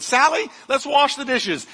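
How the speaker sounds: high-pitched, lightly acted grandma; lightly voiced grandma. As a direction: call the child over, a hint of grandma. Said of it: "Grandma said".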